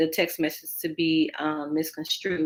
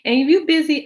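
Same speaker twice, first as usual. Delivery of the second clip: emphatic; 10 semitones higher, 8 dB louder than usual. Real, not acted. Sudden emphatic raise.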